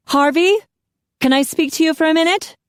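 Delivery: loud, high call into the next room; officer calling across the house. A narrator's reading. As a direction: call out to the partner. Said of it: "She raised her voice".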